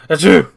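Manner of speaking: sharp sneezing sound; loud fake sneeze. Character sneezes.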